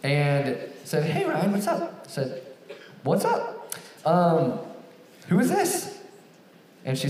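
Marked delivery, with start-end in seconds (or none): 1.0-2.0 chirpy caller. Retold or imitated. Caller Jessica voiced in story. 3.0-6.0 confused surprise. Retold or imitated. Confused reply to unknown caller.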